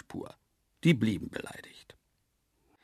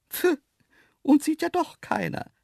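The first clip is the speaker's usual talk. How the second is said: high, loud, pert animal voice; silvery, cheeky laughing; 5 dB louder than usual. A narrator's reading. Antelopes brushing off the man's teasing, cheeky. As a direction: toss it back brightly and impudently. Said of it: "lachten sie silberhell und frech".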